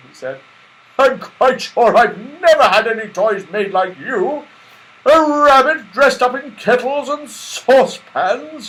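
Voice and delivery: high, loud, booming Santa; jolly Santa Claus. Acted. Santa Claus admiring Silky, high, loud and jolly. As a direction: marvel at the lovely doll.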